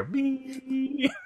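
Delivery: high, sustained bleep tone; imitated censor bleep. Podcaster mimicking the bleep over a character's swearing, high held beep. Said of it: "it's all bleeped".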